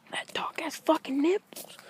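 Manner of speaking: whispered aside in disbelief. Real, not acted. Man whispering his shock to the camera. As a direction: breathe it to the camera.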